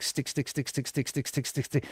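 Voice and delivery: quick, hushed string of identical little beats; very soft, evenly ticking repetition; imitated sticking. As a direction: repeat it softly like tiny things attaching one by one. Imitates density sticking to her.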